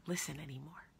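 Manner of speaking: drained whisper. Retold or imitated. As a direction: fade the words to a whisper. Voicing her exhaustion.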